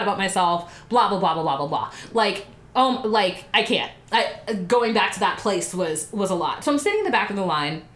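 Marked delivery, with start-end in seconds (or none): none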